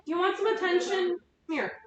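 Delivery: high sing-song voice for the cat. Real, not acted. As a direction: coo up high to call the cat over. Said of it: "I have to talk in my cat voice".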